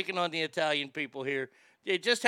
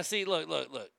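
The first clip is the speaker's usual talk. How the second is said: ordinary talk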